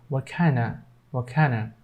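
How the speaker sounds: "What kinda" softly, one word ending in "uh". Shown as a demonstration. Teacher showing the reduced "What kinda", of as A. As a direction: turn the "of" into "uh" in "What kinda", silent T. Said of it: "they pronounce A there".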